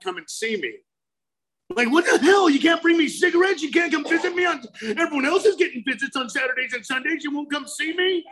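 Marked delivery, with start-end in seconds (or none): 1.7-8.2 retold rehab resentment, voice pitched up and shouting; indignant rant. Retold rant at his family.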